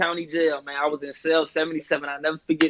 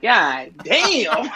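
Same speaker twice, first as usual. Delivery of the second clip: laughing dismay; 4 semitones higher, 4 dB louder than usual. Retold or imitated. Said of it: "I was like".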